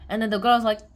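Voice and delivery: ordinary talk, nothing marked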